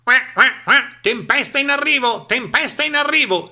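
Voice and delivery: high, loud quacking duck; squawking duck. A narrator's reading. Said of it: "schiamazzò Papera". (Papera che schiamazza)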